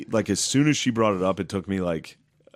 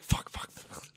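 whispered panicked curses; muttered self-reproach in a whisper; 11 dB quieter, slower than usual. Retold or imitated. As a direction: hiss the curses under your breath. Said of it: "just be like".

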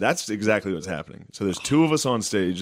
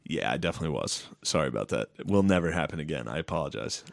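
contrite apology; 5 semitones lower, 5 dB quieter, faster than usual. Retold or imitated. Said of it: "to finally be like".

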